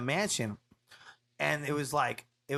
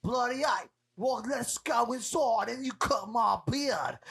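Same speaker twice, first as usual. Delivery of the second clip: mock British bloke, high and slow; lightly hammed British rocker voice; Russell Brand impression; 8 semitones higher, slower than usual. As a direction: drawl it like a cheeky Londoner. Quoting Russell Brand at the shop.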